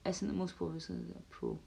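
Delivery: usual speaking voice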